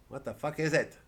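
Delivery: usual speaking voice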